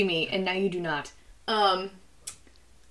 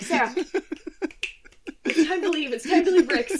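mock stern friend; 5 semitones higher, 9 dB louder than usual. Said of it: "was like".

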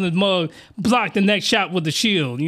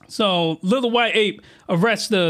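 usual speaking voice

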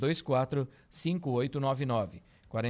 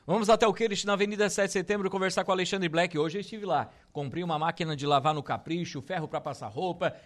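high, loud, excited promo voice; hyped radio announcer; 5 semitones higher, 5 dB louder than usual. Acted. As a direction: sell it like a radio hype man. Radio host hypes the store.

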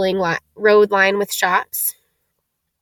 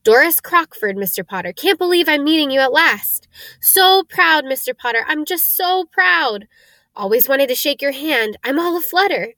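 high-pitched, fluttery excitement; gushing admiration; 5 semitones higher than usual. Acted. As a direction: gush like a thrilled admirer. Doris gushes while meeting Harry.

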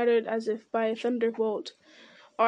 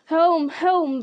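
high, loud battle cry; rally the charge with the name; riders shouting; 6 semitones higher, 6 dB louder than usual. A narrator's reading. Riders charging to Helm's horn, loud battle cry. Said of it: "the riders shouted".

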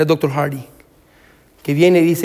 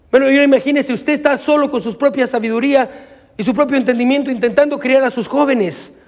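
higher-pitched, driving sermon delivery; impassioned preaching; 8 semitones higher than usual. Real, not acted. Pastor warning parents to build on Jesus, impassioned preaching. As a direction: preach it urgently to the congregation.

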